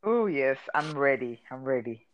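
ordinary delivery

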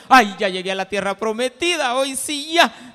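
loud, mock-elated exclaiming; hammed-up joyful boasting; exultant. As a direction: crow it out at high volume. Acts a believer exulting on arrival.

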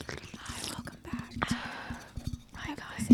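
soft whispered welcome; ASMR whisper. Pretend. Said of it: "let's do some A S M R".

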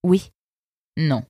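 a cool, sardonic river guard; fox guard's voice. Acted. Eunice sizing up the arrivals, lightly voiced sardonic fox. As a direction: speak down to the newcomers, unimpressed.

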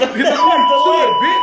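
high, drawn-out mock insult; going off. Cursing the woman in the back seat, mock furious. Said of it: "the more I'm going off".

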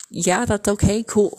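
loud, breezy fake approval; loud mock dad approval. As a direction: belt out a sarcastic okay. Imagined dad's approving reply.

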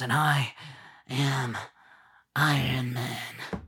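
slow mock-dramatic delivery with pauses; Iron Man. Fan mocking the hero's last line, slow and dramatic. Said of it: "who talks like that?".

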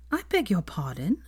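ordinary delivery, nothing marked

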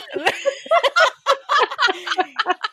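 a high burst of laughter; high delighted laugh. Real, not acted. Reliving the job offer, she laughs high and long.

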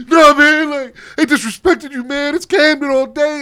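high, loud mock indignation; acted riled-up retort. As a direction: imitate a fired-up wrestler defending his town. Acting out wrestler riled up at insult.

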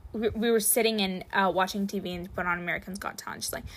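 normal talking voice

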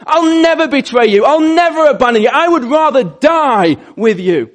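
high, loud and fervent promise; impulsive vow. Acted. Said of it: "he just kind of responds impulsively".

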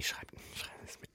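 unvoiced whispered aside; whispering. Real, not acted. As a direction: whisper it conspiratorially.

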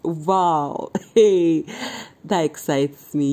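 ordinary talk

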